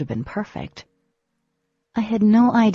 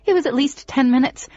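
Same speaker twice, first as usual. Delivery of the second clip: louder and higher, cracking at the end; upset insisting; 5 semitones higher, 5 dB louder than usual. A narrator's reading. Girl telling Demon she thought him dead, upset. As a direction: push back, then falter. Arguing he was gone long.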